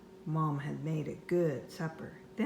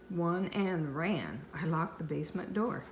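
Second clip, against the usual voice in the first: usual speaking voice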